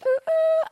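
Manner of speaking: very high "ooh, ooh" hoot; playful falsetto hoot. Real, not acted. Hoots after shout-out.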